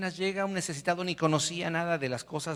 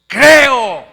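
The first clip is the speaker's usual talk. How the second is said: loud, high shout of "Creo"; 11 semitones higher, 22 dB louder than usual. Shown as a demonstration. Preacher makes congregation shout "Creo", very loud. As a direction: lead the church in yelling "Creo". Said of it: "A ver, di conmigo".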